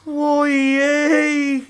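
high, drawn-out wail. A narrator's reading. Friend wails after searching the drawers, dismayed. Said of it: "ja parkaisi sitten".

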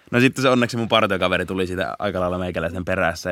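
normal talking voice

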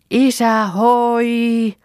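loud, high call with a long held "hoi". Acted. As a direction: holler it far across the water, drawn out. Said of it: "Huudetaan yhtä aikaa".

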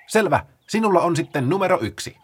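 high and quick, slowing at the end; eager Paula. Acted. Paula rattles off the rules to the sloths, high and fast. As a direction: explain it like an excited organiser.

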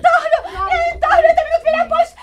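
high, loud frantic refusal; hysterical refusal. Acted. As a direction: scream that she won't go. (sisar kirkuu kieltäytyen hysteerisesti)

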